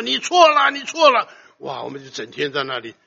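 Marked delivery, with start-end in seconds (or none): 0.0-1.3 high, loud cry of accusation; loud shout. Acted. Preacher voices the conscience crying out at a wrongdoer, shouting. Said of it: "大声地喊说".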